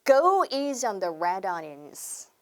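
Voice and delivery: slow, careful "go easy on"; drawn-out teaching demo; slow model phrase. Shown as a demonstration. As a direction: lay out each word for the class to copy. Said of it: "한번 해볼게요".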